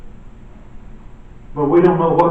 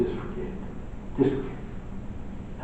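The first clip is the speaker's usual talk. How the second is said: whispered, low brush-off; dismissive whisper; 11 semitones lower, 13 dB quieter than usual. Pretend. Imagined Jesus giving up on the people, whispered dismissal. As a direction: wave them away under your breath.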